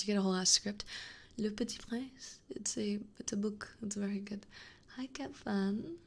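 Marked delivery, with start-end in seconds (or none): 5.0-6.0 slow, higher coo; cooing, lingering hello; fond greeting. Real, not acted. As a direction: draw the hello out sweetly with a rising end.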